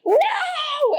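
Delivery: very high, drawn-out wail of no; hammed-up cry of missing out; anguished no. Hears friends met the star.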